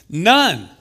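forceful preacher's exclamation; emphatic shout. Acted. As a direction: hammer it home. Preacher hammers home no condemnation.

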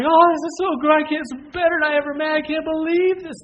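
high, loud, gushing imitation of amazed fans; overwhelmed. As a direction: gush as if overcome with amazement. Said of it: "everybody's crying. It's like".

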